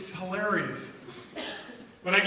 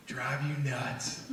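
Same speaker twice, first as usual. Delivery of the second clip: slow deadpan; 6 semitones lower, 7 dB quieter, much slower than usual. Real, not acted. Punchline dropped low.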